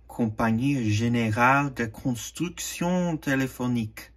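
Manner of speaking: company name said with a careful French accent. Shown as a demonstration. Collector reading the maker's name in a French accent. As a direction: give the French name its French sounds. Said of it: "Sorry for my French accent there".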